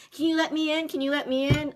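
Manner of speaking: put-on a locked-out thirteen-year-old begging; plain; pleading kid. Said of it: "I'm like".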